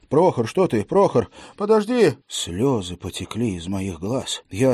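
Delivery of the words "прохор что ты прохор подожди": rising, louder, frantic calling; desperate plea. A narrator's reading. Officer to dying servant, frantic pleading. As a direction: beg him not to go.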